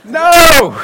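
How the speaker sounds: sudden loud, high shout; emphatic shouted no. Pretend. Preacher overturning his own joke to the congregation, booming shouted no. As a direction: belt out a drawn-out comic "no". Mock-correcting the well-dressed volunteer joke.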